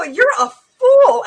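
high, loud, slow scolding; emphatic rebuke. Retold or imitated. Woman rebukes the Satanist to his face, emphatic and loud. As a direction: drive the insult home, drawn out.

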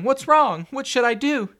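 very high, loud panic; frantic, helpless scream; screaming, crying. A narrator's reading. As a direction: plead loudly in terror. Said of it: "she screamed, crying".

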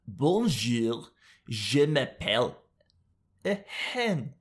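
lightly voiced French hen, mock French accent; a hint of a French hen introducing herself. Vlogger playing a French hen, mock French accent. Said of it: "what a French hen sounds like".

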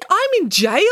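high, loud startled question; exclaiming. A narrator's reading. Nanny learning she is in jail, shocked exclamation. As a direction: pitch it up in disbelief. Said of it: "exclaimed Nanny Piggins".

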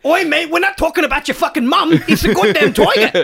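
indignant London bloke; British accent. Pretend. British character scolds narrator.

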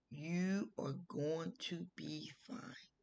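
slow, soft, deliberate promise; retold reassurance. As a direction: reassure gently, word by word. Godfather reassuring her before surgery.

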